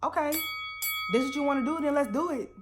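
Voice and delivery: squaring up, fine, bring it; mock defiant challenge. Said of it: "I'm just like".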